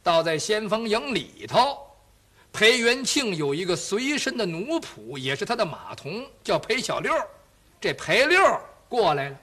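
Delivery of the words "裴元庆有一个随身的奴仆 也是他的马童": ordinary delivery, nothing marked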